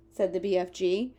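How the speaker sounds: usual speaking voice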